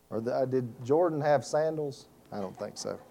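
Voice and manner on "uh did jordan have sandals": ordinary talk, nothing marked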